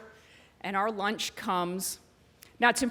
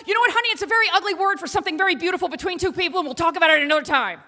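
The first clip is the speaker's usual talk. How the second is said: high, loud, very fast rush; re-enacted panicked mom bailing out in one breath; flustered backtracking; 8 semitones higher, 5 dB louder, much faster than usual. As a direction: gabble it out to end the subject. Mother bails out of awkward explanation.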